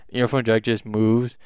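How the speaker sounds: plain reading voice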